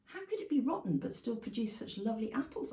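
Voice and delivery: ordinary delivery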